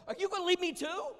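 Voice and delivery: high, loud, hurt question; Jesus asking the twelve, high and aching; pleading Jesus. Acted. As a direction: ask it like a friend afraid of being left.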